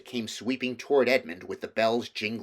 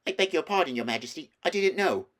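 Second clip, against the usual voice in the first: higher, hurried, nervous apology; flustered apology; 7 semitones higher, faster than usual. A narrator's reading. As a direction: blurt it out, caught off guard. Edmund apologising flustered to queen.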